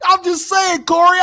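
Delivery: hammed-up mock of a rich guy bragging to Corey; Vlad impersonation. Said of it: "how does Vlad laugh?".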